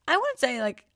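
usual speaking voice

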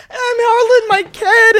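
loud, high, stretched-out laughing words; cracking up while talking; laughing hard. Real, not acted. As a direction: squeal it through real laughter. Laughing at her own lie exposed.

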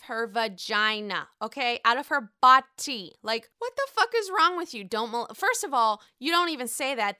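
loud heated scolding; furious viewer ranting at a TV husband; angry rant. Real, not acted. Ranting at Michael on TV.

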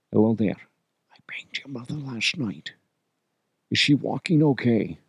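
hushed, lewd Scottish-star whisper; whispered Connery. Acted. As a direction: murmur it into someone's ear, in character. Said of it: "Unless I whisper it in your ear".

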